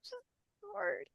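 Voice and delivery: holding back tears. Real, not acted. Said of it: "I'm trying to, like, not cry".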